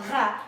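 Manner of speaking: "ra" with a French R at the back of the tongue. Shown as a demonstration. Coach shows the French R sound, back of tongue. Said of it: "the back of the tongue".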